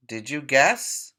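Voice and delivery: normal talking voice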